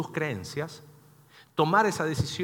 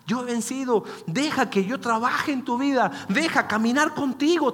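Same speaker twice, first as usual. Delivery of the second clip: rising higher and louder on each promise; fervent preaching; 8 semitones higher, 4 dB louder than usual. Acted. Pastor voicing Christ's words to the hurting, fervent preaching. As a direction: build the promise to a climax.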